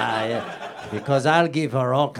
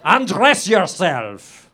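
villain command; 6 semitones higher, 8 dB louder, much slower than usual. Acted.

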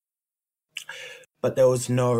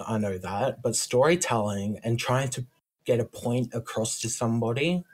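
ordinary delivery

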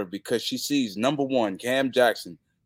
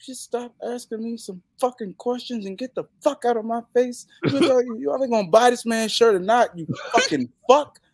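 high, louder exasperated rant; ranting at a fan; 12 semitones higher, 4 dB louder than usual. Retold or imitated. Wrestler tells off a nagging fan, exasperated rant.

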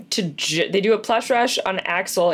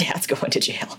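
hushed unvoiced character line; a whispery little toy voice; quoting Buttercup; 5 semitones lower, 6 dB quieter than usual. Retelling the movie, she whispers Buttercup's line.

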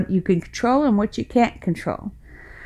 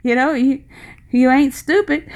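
higher, half-laughing "good golly" incredulity; a mother protesting she did fine, chuckling; exasperated disbelief; 8 semitones higher, 3 dB louder than usual. Retold or imitated. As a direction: parent huffing that her grown kids turned out fine. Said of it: "you're sitting there thinking".

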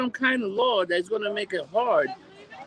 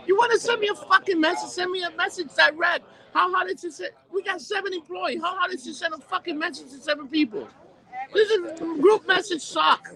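fed-up rant; 7 semitones higher than usual. Real, not acted. Ranting about group messages.

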